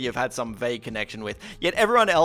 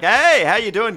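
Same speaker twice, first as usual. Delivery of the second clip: loud, higher-pitched passing hello; breezy greeting; 6 semitones higher, 9 dB louder than usual. Acted. Greeting an acquaintance without stopping to chat, breezy and loud. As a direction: throw out a big hello in passing.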